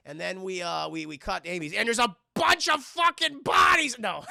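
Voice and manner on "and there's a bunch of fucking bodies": high, loud fake-shocked yell; hammed-up shouted reveal; mock horror shout. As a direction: blurt a bogus gruesome reveal at full volume. Mock horror reveal, then retracts it.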